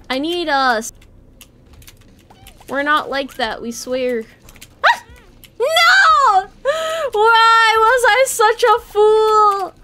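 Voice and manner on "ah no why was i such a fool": high, slow, hammed-up despair; anguished wail. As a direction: wail it like a tragic hero. Game loss, dramatic lament.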